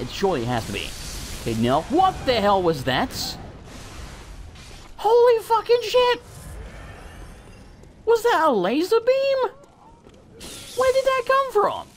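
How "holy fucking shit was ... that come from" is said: very high-pitched, stunned exclaiming; shocked outcry. Real, not acted. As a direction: cry out in real shock at a sudden attack. Sudden laser attack in the game.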